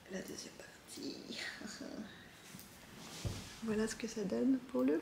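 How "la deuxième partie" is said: 1 whisper. Real, not acted. Suddenly whispered aside.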